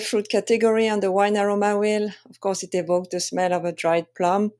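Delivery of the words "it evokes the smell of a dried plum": normal talking voice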